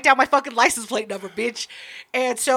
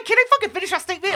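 fast, higher, fed-up demand; exasperated; 7 semitones higher, faster than usual. Real, not acted. Interrupted while telling her side, exasperated and fast. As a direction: rush it out, clearly annoyed.

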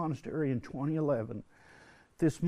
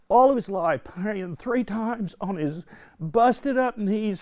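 higher and louder, slow, pleading build; fervent preaching; 8 semitones higher, 9 dB louder than usual. Real, not acted. Retreat speaker preaching his dad's faith, building fervently. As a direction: preach it with rising passion about his father's holy life. Rising fervour about his dad.

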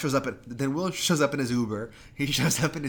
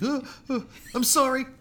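high, breathy-free mock apology, much higher; Abigail face acting; 13 semitones higher, 3 dB louder than usual. Mocking Abigail's acting to a podcast cohost, high mock apology. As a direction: overact a fake sorry like Abigail. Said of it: "doing her Abigail face acting".

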